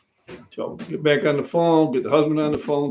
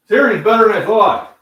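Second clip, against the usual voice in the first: surprised; 8 semitones higher, 11 dB louder than usual. Real, not acted. Surprised Siri heard him.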